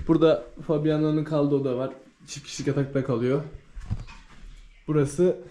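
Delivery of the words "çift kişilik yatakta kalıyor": ordinary delivery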